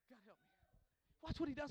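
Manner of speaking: normal talking voice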